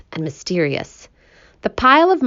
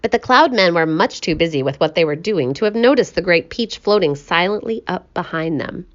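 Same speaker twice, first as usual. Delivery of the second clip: plain reading voice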